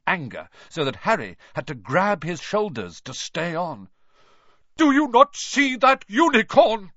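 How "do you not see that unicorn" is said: much higher and louder angry roar; bellow. A narrator's reading. Firenze rears up and shouts at Bane, furious bellow. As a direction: bellow it in his face. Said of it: "Firenze bellowed at Bane".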